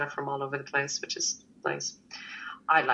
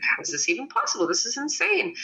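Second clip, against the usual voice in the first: stunned disbelief; 6 semitones higher than usual. Retold or imitated. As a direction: pitch jumps up on insane, retold amazement. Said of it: "I'm like".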